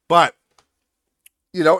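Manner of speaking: usual speaking voice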